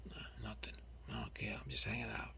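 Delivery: whisper. Retold or imitated. Retold reply, whispered.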